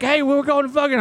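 high-pitched imitation of a buddy; oblivious army buddy. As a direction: mimic the intruding friend in a high voice.

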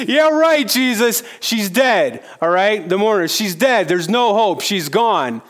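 high, loud, sneering scorn; mock him like he's a fool; the hired mourners jeering at Jesus, high and loud; mocking disbelief.

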